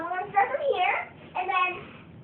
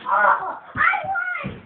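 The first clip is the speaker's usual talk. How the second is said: high-pitched victory cry; excited yell; 12 semitones higher, 5 dB louder, much slower than usual. Real, not acted. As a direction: squeal out the win. Winning the final race.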